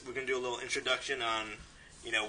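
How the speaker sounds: ordinary talk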